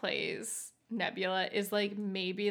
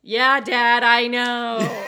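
loud mock-exasperated shout; exasperated kid; 3 semitones higher, 13 dB louder, much slower than usual. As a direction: cut off Dad's old story with a drawn-out yell. Child fed up with repeated story.

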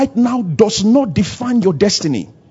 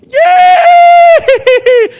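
high, drawn-out laugh into a loud squeal; shrieking, exaggerated laugh; demonstrative laughter; 9 dB louder than usual. Shown as a demonstration. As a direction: laugh long and high, then cheer "yay". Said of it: "You laugh".

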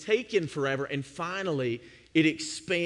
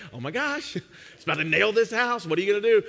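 alarmed; 4 semitones higher, 5 dB louder than usual. Retold or imitated. Wife's alarmed look.

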